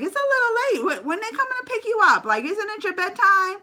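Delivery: high, quick fussing mom voice; motherly scolding. Retold or imitated. Playful mom asking girls about bedtime, high fussing voice. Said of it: "I was getting mom on".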